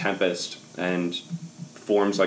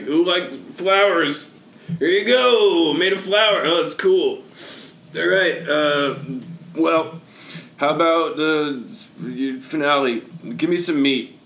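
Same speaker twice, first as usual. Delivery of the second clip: put-on overbright kids party host; 8 semitones higher, slower than usual. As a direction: stumble in then sell the magic. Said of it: "And be like".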